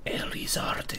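soft, mock Spanish accent. Co-player echoing the lizard's name, soft mock Spanish accent. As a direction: echo it softly with a Spanish accent. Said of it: "say that with a Spanish accent".

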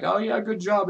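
very high, loud mushy praise; mock gushing. Gamer mimicking Facebook congratulations, mock gushing. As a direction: gush in a squeaky-high mocking pitch. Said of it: "mushy shit like".

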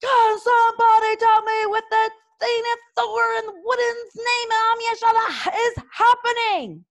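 high, loud, furious bellow; ballistic bellow. A narrator's reading. Gobber bellowing at the brawling dragons, furious. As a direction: roar it across the field. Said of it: "went ballistic".

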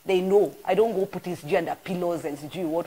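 usual speaking voice

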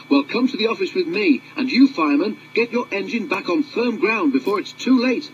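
high, brisk foreman's orders; ordering foreman. Acted. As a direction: give firm commands to the crew. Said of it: "ordered the foreman".

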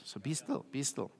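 soft, calm "be still" twice; quiet the room with it; gentle hushed preaching. Real, not acted. Preacher calms the congregation.

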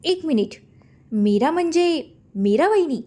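the penny drops, pitch jumps; astonished realisation. A narrator's reading. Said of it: "दीप आश्चर्याने रश्मीकडे बघून".

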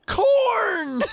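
high, loud gleeful yell; excited shout. Real, not acted. Finding corn in the game, an excited shout. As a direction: belt it out like a prize.

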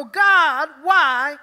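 high, loud cry of "God, why?"; anguished plea. Acted. A struggling believer crying out to God, a loud anguished plea. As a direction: plead up at heaven.

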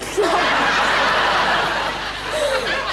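burst of laughter. Real, not acted. Caller corpses on phone.

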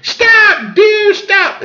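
high-pitched squealed command; hammed-up female falsetto; mock shriek. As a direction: squeal after a runaway animal. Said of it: "and she goes".

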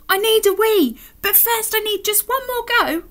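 high, urgent little bear voice; pleading little bear. Acted. Alan needing a wee but wanting another go, pleading bear.

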